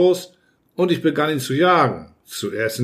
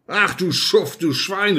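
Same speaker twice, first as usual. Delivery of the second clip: high, outraged exclamation; pitched-up, scandalised name-calling; indignant cry; 6 semitones higher, slower than usual. A narrator's reading. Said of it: "rief ich".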